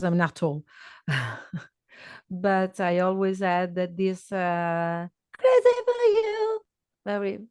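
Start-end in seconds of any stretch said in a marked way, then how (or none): 5.4-6.6 put-on sung high in a thin girlish voice; thin teenager voice. Shown as a demonstration. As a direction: lift into a thin, high teen voice. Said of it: "very thin voice, teenager voice".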